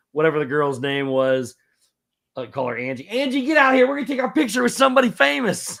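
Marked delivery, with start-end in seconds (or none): 3.1-5.8 high, loud, thrilled calling out to a friend; excited shout. Retold or imitated. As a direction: call her friend over, giddy with excitement. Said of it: "And she goes".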